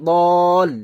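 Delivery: বল said loud, closed firmly on the l; lam joined. Shown as a demonstration. Teacher joining the lam for learners, a loud closed বল. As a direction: hold বল and land on the lam. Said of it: "লাম যুক্ত করছি".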